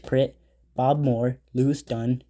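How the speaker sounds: usual speaking voice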